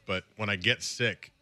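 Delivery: normal talking voice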